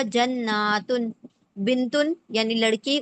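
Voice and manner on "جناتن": jannatun stretched out slowly, syllable by syllable; slow Arabic plural spelled out by sound; slow demo pronunciation. Shown as a demonstration. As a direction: draw out جناتن to show the plural ending. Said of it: "جنتن کا plural کیا ہوگا؟".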